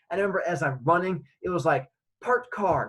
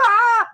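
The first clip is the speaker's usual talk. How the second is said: loud, high answering shriek; terrified scream; 12 dB louder than usual. Retold or imitated. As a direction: scream right back at him. Child screams back at man.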